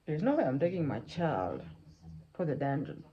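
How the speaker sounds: lightly voiced indignant servant; defiant servant. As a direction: refuse the king point-blank. Servant refuses the king's order.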